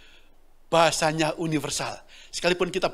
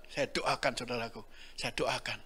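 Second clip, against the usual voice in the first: quiet, hushed telling; 4 semitones lower, 8 dB quieter than usual. Retold or imitated. Quiet recounting of prayer.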